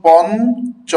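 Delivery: পঞ্চ high and loud, split as pon-cho; demonstrated pronunciation. Shown as a demonstration. Teacher drawing attention to the sound, পঞ্চ high as pon-cho. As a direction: say পঞ্চ loudly in two parts, pon, cho. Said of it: "এই দিকে লক্ষ্য করুন".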